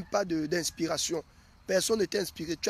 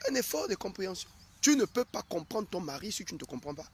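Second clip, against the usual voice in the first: ordinary delivery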